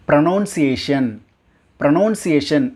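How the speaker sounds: pronunciation as pro-NOUN-see-ay-shun; common mispronunciation. Shown as a demonstration. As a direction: put noun inside pronunciation. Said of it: "அப்படின்னு தான் எல்லாருமே".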